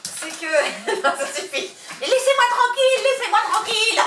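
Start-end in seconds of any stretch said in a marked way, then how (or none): 2.0-4.0 high, loud playful voice for the dog; dog's voice. Pretend. Owner voicing the dog pestered by the puppy, high playful squeal.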